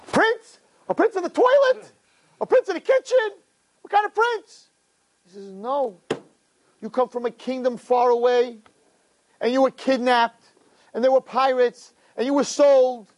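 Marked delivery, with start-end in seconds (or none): 0.1-4.7 mocking disbelief. Toilet cleaner mocking the old man, high scoffing voice. Kid mocks old man's claim. 5.5-13.1 high, urgent telling of the kidnap tale; insistent old man. Acted. Old man insisting to the kid he is a prince, high and urgent.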